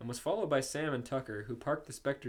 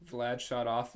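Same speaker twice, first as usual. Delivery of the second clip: ordinary delivery, nothing marked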